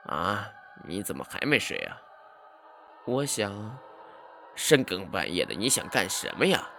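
lightly voiced uncle scolding the boy; old uncle, mildly annoyed. A narrator's reading. Uncle voice in story. (略带不耐烦的舅老爷)

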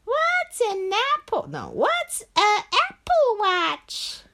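put-on high, slow puzzled kid. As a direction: ask like a clueless kid.

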